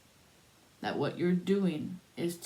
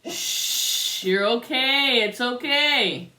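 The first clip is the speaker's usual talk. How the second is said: slow, louder hushing reassurance; calm her down, shh then slowly; soothing; 7 semitones higher, 9 dB louder, much slower than usual. Acted. Comforting the crying woman, slow and reassuring.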